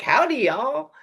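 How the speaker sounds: high, loud goofy Southern drawl of Jeff Foxworthy. Retold or imitated. Comic opening a show with a big goofy Southern hello. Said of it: "he's creating that kind of goofy Southern".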